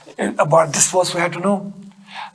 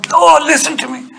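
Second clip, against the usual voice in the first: raised, high-pitched mock shout; demonstrated shout; 10 semitones higher, 5 dB louder than usual. Shown as a demonstration. Preacher showing why shouting at listeners is wrong, demonstrated shout. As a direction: shout it loud and high to show bad manners. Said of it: "if I start shouting these words".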